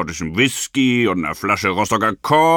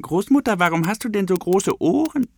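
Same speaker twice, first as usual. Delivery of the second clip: put-on little girl asking; 9 semitones higher, faster than usual. A narrator's reading. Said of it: "fragte Rotkäppchen weiter".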